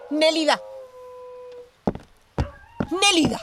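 loud, high call across the house. Acted. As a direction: call the maid from another room. Calling the maid from afar.

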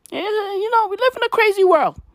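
high mock voice. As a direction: pitch it way up for the joke. Sudden high voice.

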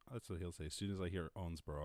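ordinary delivery

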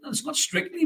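jumps high in mock disbelief; a sarcastic squeak of disbelief. Real, not acted. Mocking pundit's dance.

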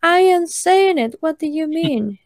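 high-pitched baffled comeback; retold protest. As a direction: act out an indignant reply. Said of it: "And it's like".

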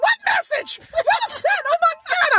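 high-pitched mock-panicked squeal; panicking. Mother learning daughter sent a message, panicked shriek. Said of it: "I was panicking".

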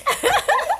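real high laughter burst. Real, not acted. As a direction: let a high laugh escape. Laughing at own story.

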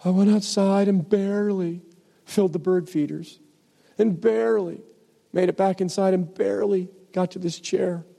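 high, halting self-pitying whine; hammed-up complaint, leaning on "barely"; whining. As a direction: whimper pitifully through each weary excuse. Acting out the whiner's reply.

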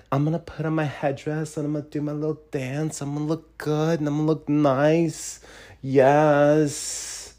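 mock her self-satisfied boast; mocking imitation. Ridiculing the woman in the headdress, soft mocking voice.

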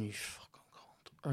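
whispered under the breath; barely voiced angry mutter; muttered curse. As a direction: hiss it so he won't hear. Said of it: "I'm going".

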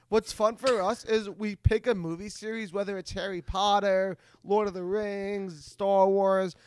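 ordinary talk

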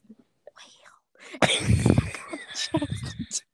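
breathy whisper. Shown as a demonstration. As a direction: whisper it softly. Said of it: "So soft".

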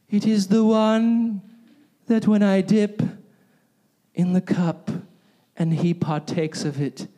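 creepy movie Jesus. Retold or imitated. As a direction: draw it out like a creepy movie prophecy. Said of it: "And he goes".

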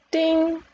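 high, ringing "ding"; imitated bell ding. A narrator's reading. Narrator voicing the round bell, high ding. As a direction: sound the bell with the voice.

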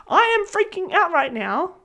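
a touch of hammed-up alarm; panicky inner voice. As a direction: voice the part of you that's freaking out. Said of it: "that voice that is going".

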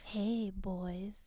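mock seductive hotline greeting; sultry hotline voice. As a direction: purr it like a phone-sex ad. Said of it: "one nine hundred number commercials".

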